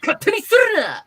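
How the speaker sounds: loud, high mock gangster yell; fake-angry yakuza shout. Teacher showing off the yakuza voice to students, mock angry shout. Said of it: "Like that".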